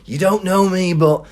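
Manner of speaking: put-on drunk bloke. Drunk former tenant greeting the student, cheery and loud. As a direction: play a chummy drunk at the door.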